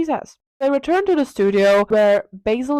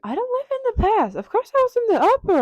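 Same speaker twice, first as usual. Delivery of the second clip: very high, airy brush-off; mock breezy dismissal; faster than usual. Dorian dismissing Basil's sympathy, high mock nonchalance. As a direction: toss off the question, playfully acted.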